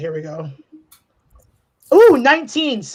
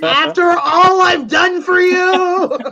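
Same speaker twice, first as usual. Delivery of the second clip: mock betrayed; 7 semitones higher, 5 dB louder than usual. Mock betrayal over a bad roll.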